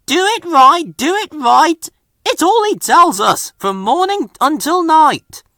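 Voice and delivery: mock the nagging rule; mocking imitation. A narrator's reading. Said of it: "There he goes again".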